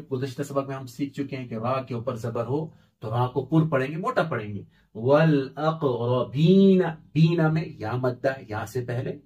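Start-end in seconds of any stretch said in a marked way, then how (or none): 4.9-6.8 वल अक़रबीन stretched very slowly, with a full heavy रा; drawn-out careful read of वल अक़रबीन; slow Quranic reading. Shown as a demonstration. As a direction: recite वल अक़रबीन slowly, the रा thick.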